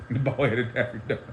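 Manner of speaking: giggling the insult out; real laughter breaking through the words; quiet amused giggle; laughing through it. Real, not acted.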